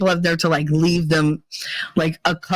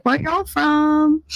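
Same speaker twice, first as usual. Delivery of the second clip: Southern drawl; 10 semitones higher, much slower than usual. Retold or imitated. Southern waitress chatting to tourists.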